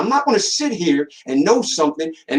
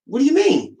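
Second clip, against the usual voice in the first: nurse's surprise; 10 semitones higher than usual. Retold or imitated. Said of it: "She says".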